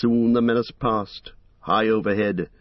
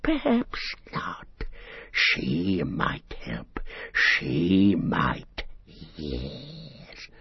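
far-off, creaky creature voice arguing with itself; Gollum's inner debate; 4 semitones lower, 5 dB quieter, much slower than usual. Acted. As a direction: Gollum muttering a sly debate with himself.

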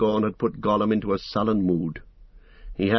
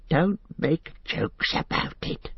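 hissed; 7 semitones higher, slower than usual. A narrator's reading. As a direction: hiss it back, offended. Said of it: "hissed Gollum".

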